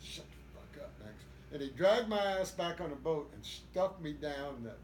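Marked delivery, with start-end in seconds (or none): 0.0-1.1 hushed retold order. Buddy hushing him after fight.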